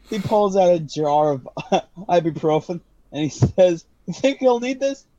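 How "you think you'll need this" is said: shopkeeper voice. Acted. GM voicing shopkeeper Garfield.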